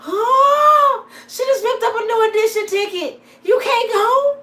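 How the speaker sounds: high, loud squeal of disbelief; stunned child at the ripped ticket, squealing; shocked kids. Retold or imitated. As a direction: gasp it out like a stunned kid.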